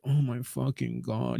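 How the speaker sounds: hushed, slow mock swoon; awestruck gasp. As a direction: swoon over him under your breath. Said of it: "she'd be like".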